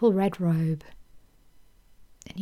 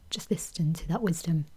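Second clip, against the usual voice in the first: put-on soft whisper from the inner child; whispered answer; 3 semitones lower, 6 dB quieter than usual. Younger self answering the grown woman, whispered.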